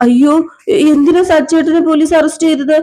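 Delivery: high-pitched shocked question; acted dismay. Varsha hears of the arrest.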